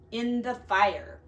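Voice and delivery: ordinary delivery, nothing marked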